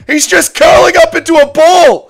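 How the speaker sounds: very high, loud disbelieving shout; shocked yelling. Real, not acted. Streamer yells at fighter on screen, shocked shout.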